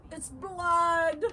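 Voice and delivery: high, loud cry; yelled. A narrator's reading. Loretta shows police her brother's finger, yelling. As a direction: yell it out, stretching the last word. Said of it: "pointed at her brother's finger and yelled".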